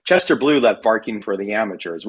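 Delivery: ordinary delivery, nothing marked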